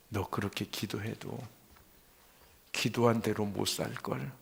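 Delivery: hushed doubting voice; quiet inner doubt. Retold or imitated. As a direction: needle softly, like a voice inside the head. Inner voice doubting his prayer.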